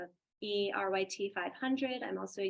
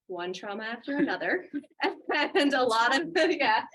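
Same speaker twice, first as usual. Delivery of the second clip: rueful laugh, getting louder; 4 semitones higher, 8 dB louder than usual. Real, not acted. Laughing at own trauma list.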